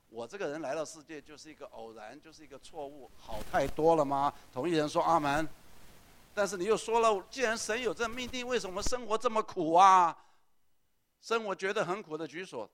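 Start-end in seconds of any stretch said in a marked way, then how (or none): 3.2-12.7 loud, higher, urgent call to the crowd; rally the whole room; rousing preaching. Real, not acted. Pastor rousing the congregation to respond, loud fervent call.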